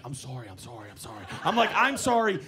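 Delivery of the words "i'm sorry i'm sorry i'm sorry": low, very soft repeated sorries; mock meek, murmured apology; hushed apology. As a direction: imitate a man apologising under his breath. Driver apologising fearfully while running.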